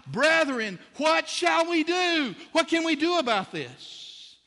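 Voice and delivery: high, loud desperate plea; crying out. Acted. Crowd crying out to the apostles, loud and desperate. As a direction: wail it out for help. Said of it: "they cried out".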